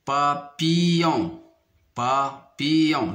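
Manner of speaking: "papillon" slowly, pa, pause, pillon; syllable by syllable. Shown as a demonstration. Teacher locating a sound for pupils, "papillon" slowly split. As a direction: break up "papillon" in slow pieces. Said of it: "la troisième syllabe".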